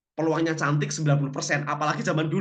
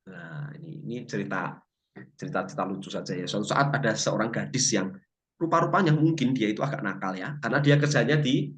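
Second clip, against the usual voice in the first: normal talking voice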